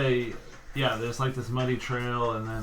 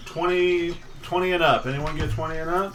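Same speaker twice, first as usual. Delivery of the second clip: raised voice calling out numbers; 5 semitones higher, 5 dB louder, faster than usual. Real, not acted. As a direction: call it out to the table. GM calling out initiative.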